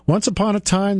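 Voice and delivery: storybook once upon a time; fairy tale opening. A narrator's reading. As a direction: open like a bedtime story.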